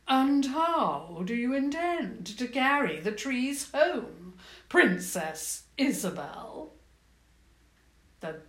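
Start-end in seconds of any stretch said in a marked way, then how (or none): 0.1-6.7 louder, mocking queen voice; goblin queen. Goblin queen mocks the princess, louder queen voice. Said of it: "a womanly voice".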